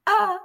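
short high imitated yell; a self-conscious first try at screaming; restrained mock scream. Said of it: "he has him screaming".

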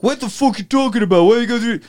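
put-on a touch of a brash kid; mock indignant kid. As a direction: snap back like a cocky teenager. Kids talking back at bar.

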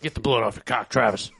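Sam impression. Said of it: "like his impersonation of Sam".

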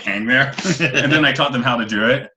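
ordinary delivery

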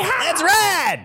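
high cowboy whoop; rebel yell. Pretend. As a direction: holler it to the posse.

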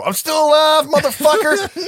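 very high, loud shrieking yell; defiant scream. Retold or imitated. Sonny screaming from the burning crawl space, shrieking defiance. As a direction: scream it from inside the fireball. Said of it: "you hear Sonny go".

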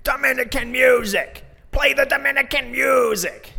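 the fired-up grandma chanting at the driver, shrieking high; old lady shouting. Retold or imitated. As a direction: imitate a tiny smoker grandma yelling her demand. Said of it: "she stands up and goes".

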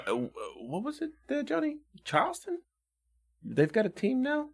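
high, soft aside to Johnny away from the mic; offstage aside. Pretend. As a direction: quietly ask the producer off to the side.

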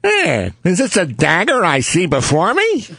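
put-on high tough-kid voice doing Hamlet lines; Shakespeare as a squeaky street kid; Robert Blake Shakespeare. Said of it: "using the Blake method".

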